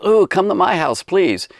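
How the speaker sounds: high, gushing invitation; lightly voiced eager villager begging guests in; a hint of a gushing host calling out.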